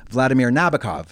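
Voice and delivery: Vladimir Nabokov stressed on VLAD and NAB; English-style stress. Shown as a demonstration. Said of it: "It's not Nabokov".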